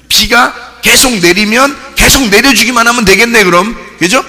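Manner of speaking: insistent pulpit delivery, pressing for agreement; emphatic preaching. Real, not acted. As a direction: drive the point home loudly. Preacher driving point home.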